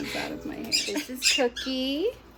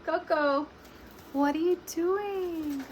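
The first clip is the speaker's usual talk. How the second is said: ordinary talk, nothing marked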